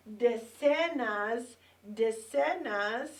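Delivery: decenas stretched slowly with rising ends; slow teaching stress. Shown as a demonstration. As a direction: lay out decenas slowly for the class. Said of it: "counting breakdown".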